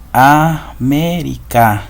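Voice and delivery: very slow, separated A-mé-ri-ca; syllable by syllable. Shown as a demonstration. Teacher dividing an example for pupils, slowly syllable by syllable. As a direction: break the word into four drawn-out syllables. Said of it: "Acá esta la dividimos".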